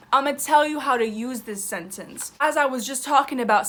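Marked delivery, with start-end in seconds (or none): none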